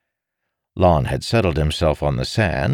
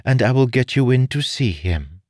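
higher-pitched, firm character voice; commanding noblewoman; 8 semitones higher, 3 dB louder than usual. A narrator's reading. Noblewoman bargaining with a troublesome petitioner, commanding. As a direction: speak as a lady laying down terms.

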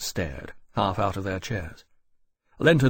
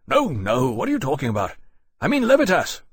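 rising to a much higher, louder burst; impatient; 5 semitones higher, 5 dB louder than usual. Acted. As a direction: brush the apology aside. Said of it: "Lenton said impatiently".

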